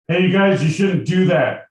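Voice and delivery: high, loud mock objection; protesting Madison. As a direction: call out a hammed-up plea to stop. Acting Madison scolding Henry and Mason.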